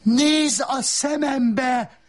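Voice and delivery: slow, very high yell; the wife yelling at the narrator. Acted. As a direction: yell it straight into his face. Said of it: "üvöltötte".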